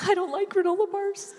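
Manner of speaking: put-on high, tight child voice fighting tears; holding back tears. A father imitates his daughter's disappointment, near tears. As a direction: mimic a little girl about to cry. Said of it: "She's trying not to cry".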